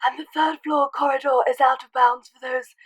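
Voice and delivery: put-on wise old headmaster, declaiming a warning; mock-solemn booming proclamation; Dumbledore impression. Vlogger shows off her ill Dumbledore voice. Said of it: "banging Dumbledore impression".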